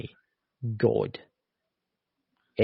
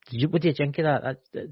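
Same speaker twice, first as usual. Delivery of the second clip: usual speaking voice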